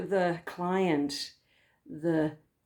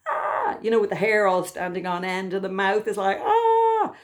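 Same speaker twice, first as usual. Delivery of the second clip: panicked cry; 3 dB louder than usual. Retold or imitated. Imitating freaked-out stick figure.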